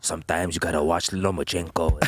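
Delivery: put-on low Brazilian-accented fighter voice; Brazilian accent impression. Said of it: "how do you do that accent".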